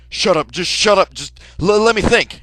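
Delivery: loud, high panicked yell; angry shout. Retold or imitated. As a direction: bark him down in panic. Panicked killer yells back.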